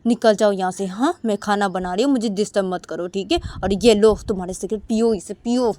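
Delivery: loud, fed-up retold scolding; annoyed shouting from the kitchen; angry wife. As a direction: shove him out with your voice. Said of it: "बोलती कि".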